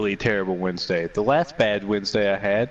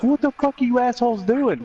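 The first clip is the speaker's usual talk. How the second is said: pitched-up mock yell at the crew; exasperated high yell; 12 semitones higher than usual. Brother yelling at coworkers standing by the factory, high mock yell. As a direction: shout it high, half laughing.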